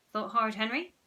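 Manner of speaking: ordinary delivery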